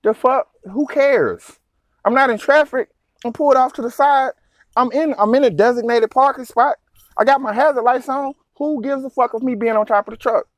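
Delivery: who cares, I'm parked legally; indignant. Real, not acted. Trucker ranting about the cop, high indignation.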